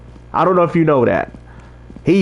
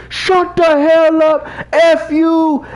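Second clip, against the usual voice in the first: high, slow, mock-furious drunk snapping back; hammed-up drunk telling someone off; angry drunk outburst; 10 semitones higher, much slower than usual. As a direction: play the angry drunk lashing out. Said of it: "The guy flipped on me".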